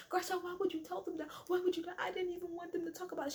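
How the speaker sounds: high, hurried mock protest; mock upset. Sutton confronting Garcelle for bringing it up, mock upset. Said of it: "Sutton gets mad at Garcelle".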